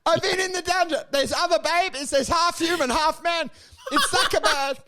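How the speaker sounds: high, frantic escaped android; high panicked runaway bot; escaped robot. Acted. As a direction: squeal it like a freed robot.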